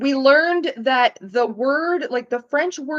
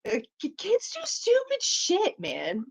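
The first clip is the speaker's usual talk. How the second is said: normal talking voice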